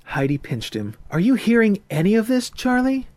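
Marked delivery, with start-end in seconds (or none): 1.1-3.1 put-on higher, louder young lady, brisk and sure; a confident swing girl setting terms; young woman's voice. A narrator's reading. Heidi's voice in the story.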